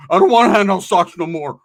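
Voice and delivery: very loud put-on protest; mock outburst. Inmate bound for the hole, loud mock protest.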